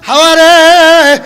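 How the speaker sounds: loud, drawn-out chanted call; slow wailing summons in sermon chant. Retold or imitated. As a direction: call her name slowly, stretching the last syllable. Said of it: "আদম নবী ডাক দিয়ে কয়".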